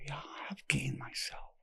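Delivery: whispered in quiet realization; awed, whispered self-discovery; hushed whisper. Retold or imitated. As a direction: breathe the words out softly. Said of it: "And he just go".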